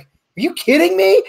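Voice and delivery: high, slow incredulous question; a retold friend's stunned disbelief. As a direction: let the disbelief climb on every word. Retold friend hearing the news.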